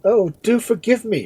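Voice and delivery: high, loud begging cry; shrieked plea. A narrator's reading. Edmund begging the dying man's pardon, shrieking. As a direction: shriek for forgiveness. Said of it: "shrieked Edmund".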